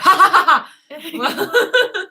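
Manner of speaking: high bursts of laughter; laughing. Real, not acted. Laughing at deep voice remark.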